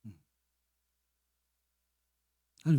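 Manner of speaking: normal talking voice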